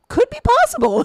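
high, loud mock awe at a silly idea; mock amazement. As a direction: squeal it in mock wonder. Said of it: "you're like".

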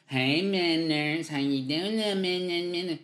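slow, drawn-out coo at a dog, lightly mocked; mock his friend cooing at her dog; imitating dog baby talk. Chelsea cooing to her dog, imitated as slow mock baby talk. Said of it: "she talks to her dog like this".

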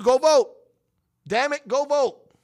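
loud, insistent push to go vote; urging everyone to vote. Real, not acted. Host urging his audience to get out and vote, loud and insistent. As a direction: bang the table and demand they vote.

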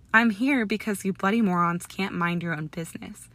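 ordinary delivery, nothing marked

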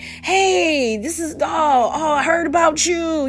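high, drawn-out fawning hello; gushing greeter. Acted. Unclean spirit greeting Jesus, gushing high hello. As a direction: gush as if meeting someone famous. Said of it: "it was basically like".

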